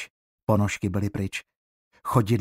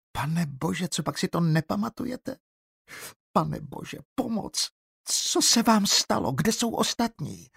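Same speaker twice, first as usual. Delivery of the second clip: put-on panicked girl; 9 semitones higher than usual. Girl panics, cries for help.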